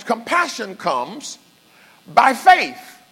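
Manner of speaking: high, loud preached line. Shown as a demonstration. Preacher proclaiming to the church, loud and high. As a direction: hammer faith home to the congregation. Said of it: "Okay, I got testimony".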